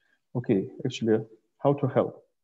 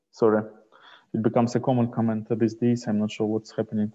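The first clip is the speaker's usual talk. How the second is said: louder to be heard; raising the voice; 8 dB louder than usual. Real, not acted. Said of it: "try to speak louder".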